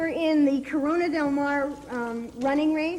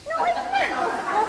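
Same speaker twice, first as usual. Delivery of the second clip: high, drawn-out comic objection; whiny protest; 13 semitones higher, much slower than usual. Real, not acted. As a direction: stretch it out high in wounded protest. Protesting partner's teasing insult.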